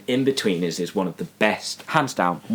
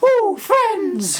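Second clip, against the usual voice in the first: very high falsetto tease; falsetto ooh. Retold or imitated. Quoting the Friends episode line, high falsetto tease. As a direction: squeal it like a teasing schoolkid. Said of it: "They'd be like".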